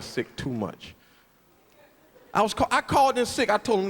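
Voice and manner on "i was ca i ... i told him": ordinary talk, nothing marked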